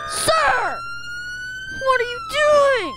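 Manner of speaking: high, loud shout at driver. Real, not acted. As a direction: yell at him to stop.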